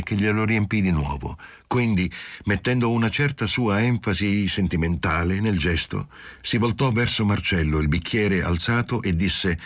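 plain reading voice

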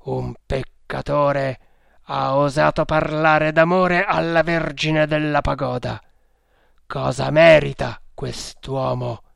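loud, solemn, declamatory charge; accusing cult leader. A narrator's reading. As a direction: build the charge toward a demand for judgement.

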